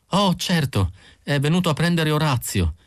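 just lift the pitch for the wife; lightly voiced Mrs Pilsen. A narrator's reading.

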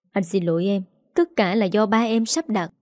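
plain reading voice

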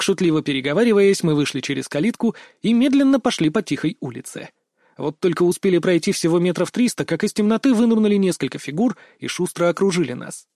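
ordinary delivery, nothing marked

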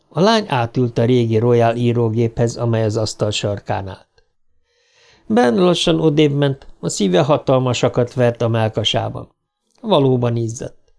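usual speaking voice

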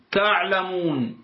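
تعلمون with the waw held two counts; تعلمون lengthened briefly on the waw; تعلمون with a short two-beat waw before a still nun; two-count length. Shown as a demonstration. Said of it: "هذه كم؟ حركتان".

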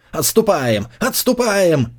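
rising, urgent repeated order; retreat order. A narrator's reading. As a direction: call the retreat, building.

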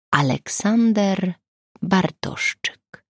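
name read out very slowly; detective pondering the name in his notebook, very slow; slow, musing. A narrator's reading. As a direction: slowly mull over the name Aleksander Bartoszczyk.

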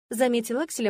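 ordinary delivery

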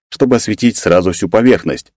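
ordinary delivery

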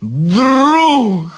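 put-on high drunken roar. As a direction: bellow it with affection. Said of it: "заревел стражник".

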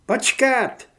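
high, loud command to halt; shouted order. A narrator's reading. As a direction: bark it from down the corridor. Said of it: "nařídil někdo ze směru". (hlasitý rozkaz)

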